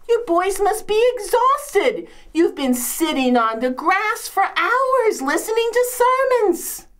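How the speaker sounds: high, hammed-up fussing over guests; mock sympathy. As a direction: gush with overdone pity.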